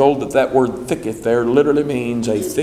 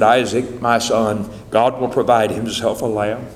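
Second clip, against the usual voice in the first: a father barely holding it together; choking back tears; 5 semitones lower than usual. Acted. Abraham answering his son Isaac, mildly tearful. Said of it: "doubtlessly choking back tears".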